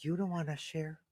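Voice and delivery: very soft, slow, wounded question; hurt, sensitive. Retold or imitated. Boyfriend retells being refused a bite, hurt and sensitive. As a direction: shrink it down, halting and crushed. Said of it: "I'm super sensitive".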